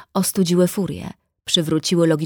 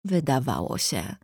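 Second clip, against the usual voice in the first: „wydawało się” set apart, lower and softer; pointed emphasis; 4 semitones lower, 6 dB quieter than usual. A narrator's reading. Narrator correcting the brother's certainty, „wydawało się” pointedly lowered. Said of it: "Z naciskiem na".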